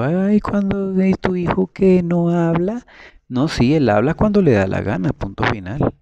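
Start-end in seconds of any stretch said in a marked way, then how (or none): 0.0-2.9 high, slow mock-pitying voice. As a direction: imitate a gossip feigning pity. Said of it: "Hay gente que se siente feliz diciendo". (imitando con burla a la gente que compadece, agudo y lento)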